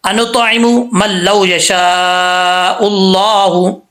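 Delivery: slow melodic tajweed chant; Quran recitation. Shown as a demonstration. Teacher recites the verse for students to repeat, chanted. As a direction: intone each word, long and measured. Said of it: "next line".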